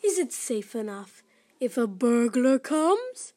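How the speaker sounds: spooky announcer asking slowly; creepy voice. Acted. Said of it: "the creepy voice".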